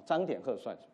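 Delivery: ordinary talk, nothing marked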